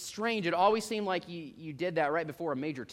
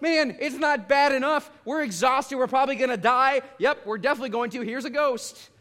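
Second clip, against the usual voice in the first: higher and louder, panicky complaint; panicked disciples; 6 semitones higher, 5 dB louder than usual. Retold or imitated. Preacher voicing disciples who panic at a ghost, higher and louder. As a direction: play the disciples fearing they'll die. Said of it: "They're like".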